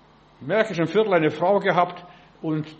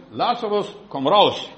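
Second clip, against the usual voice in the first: commanding call; 4 dB louder than usual. Acted. Jesus calls dead Lazarus out of the tomb, commanding call. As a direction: call out a loud command on the last words.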